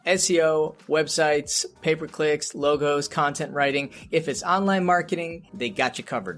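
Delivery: upbeat podcast ad read; sponsor ad read. Acted. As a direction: pitch the sponsor like a radio spot. Host reads sponsor ad.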